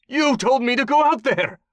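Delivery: high, loud panicked insisting; desperate shout. Acted. Tortured captive insists desperately.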